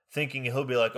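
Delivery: ordinary talk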